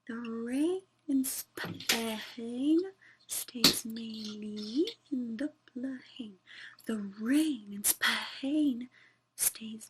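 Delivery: slow whispered practice to herself. Real, not acted. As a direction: mouth the phrase slowly under her breath. Practising alone under her breath.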